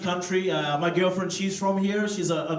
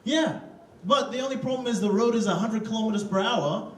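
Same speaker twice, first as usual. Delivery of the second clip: ordinary delivery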